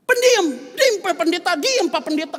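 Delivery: put-on high, loud shout of a scolding child; child's shout. Eldest child yells at arguing father, loud high shout.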